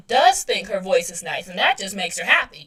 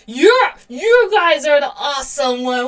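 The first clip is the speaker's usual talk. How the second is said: excited; 5 semitones higher, 8 dB louder than usual. Real, not acted. Gushing back at her viewers.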